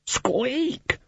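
very high squeaky rat call; rat squeak. A narrator's reading. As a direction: pipe it up like a tiny rat. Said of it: "squeaking loudly".

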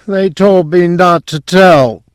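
high, far-away drugged voice; drugged mumble. A narrator's reading. Drugged patient recalls a secret pact, in a high dazed voice. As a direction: speak as if dazed by heavy sedation. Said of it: "mumbled in a dull, drug-saturated voice".

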